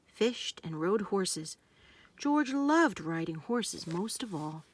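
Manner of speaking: usual speaking voice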